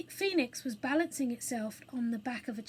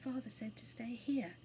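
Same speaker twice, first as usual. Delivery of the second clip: soft, restrained fright; controlled fear; 6 dB quieter than usual. A narrator's reading. Anthea very pale in the burning theatre, controlled fear. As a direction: hold fear down to sound ordinary. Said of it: "trying to speak in her ordinary voice".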